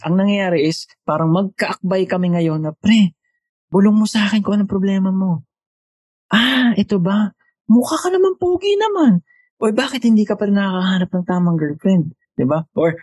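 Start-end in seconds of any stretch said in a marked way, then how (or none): 2.7-12.1 buddy banter. Retold or imitated. Friendly chat with buddy on call.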